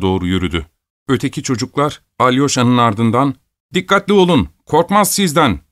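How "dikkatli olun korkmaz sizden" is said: high, raised call after someone; urgent children's cry; warning shout. A narrator's reading. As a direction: shout a caution from behind. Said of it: "diye bağırdılar".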